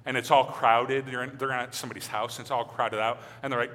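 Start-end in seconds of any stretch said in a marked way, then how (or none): none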